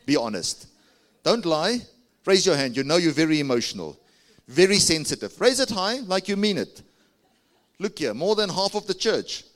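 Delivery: loud, urging call to the crowd; fiery preaching. Acted. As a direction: push them to raise their hands. Urging the crowd to raise hands.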